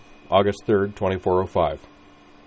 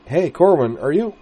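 much higher, louder breezy hello; bright cheerful call; 9 semitones higher, 7 dB louder than usual. Acted. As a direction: pop your head in and call out. Utena bursts in calling.

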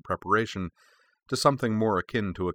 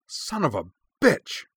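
snarled; 8 semitones higher than usual. A narrator's reading. Corwin hearing of the provocation, snarling in anger. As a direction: lash out at the news. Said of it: "he snarled".

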